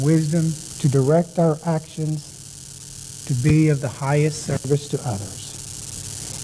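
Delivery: calm, slow, soft prayer voice; guided meditation. Acted. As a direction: guide them gently into stillness. Leads the opening prayer meditation.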